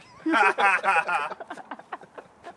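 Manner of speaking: loud laugh; laughter. Real, not acted. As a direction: laugh at your own joke. Laughs at own joke.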